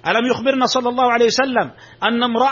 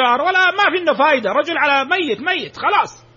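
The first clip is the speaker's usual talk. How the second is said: higher-pitched dismissive imitation; mock dismissal; 6 semitones higher than usual. Preacher mimicking critics who see no use in a dying boy, mock dismissal. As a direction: write him off, mimicking the scoffers.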